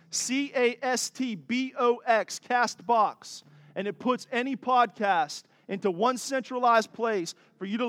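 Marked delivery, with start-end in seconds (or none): 0.1-2.4 each letter said slowly and separately: C A S T B O X; C A S T B O X, one letter at a time; spelling it out. Shown as a demonstration. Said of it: "It's called Castbox".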